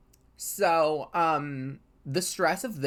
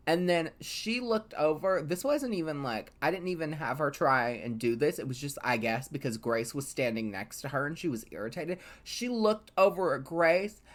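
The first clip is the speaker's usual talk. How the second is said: normal talking voice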